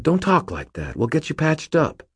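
high, insistent protest; urgent reassurance. A narrator's reading. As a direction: talk a wounded friend out of giving up. Urging a dying friend not to quit.

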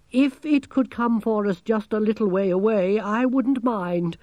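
high, quavering plea; quavered. A narrator's reading. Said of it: "Gerda quavered".

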